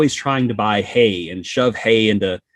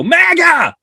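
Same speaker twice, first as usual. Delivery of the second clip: very high, loud shouted cry; mock shout; 8 dB louder than usual. Fleeing a knife-wielding killer, loud high mock shout. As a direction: yell it back over your shoulder.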